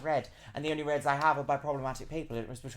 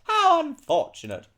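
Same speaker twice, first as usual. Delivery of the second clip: very high, loud mock lament; hammed-up wail of false regret; mock dismay; 5 dB louder, much slower than usual. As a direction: squeal it with pretend sympathy. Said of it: "And I was like".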